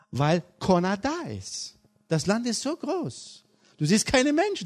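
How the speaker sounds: a preacher spinning a lively tale; animated storytelling. Acted. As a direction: tell it big to the children.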